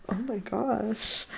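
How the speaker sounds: soft, drawn-out gasped reaction; hushed, slow mock shock; breathless shock. Girl retelling her first butt grab, breathless shock.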